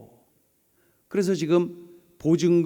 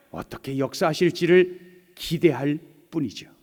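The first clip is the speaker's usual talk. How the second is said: ordinary talk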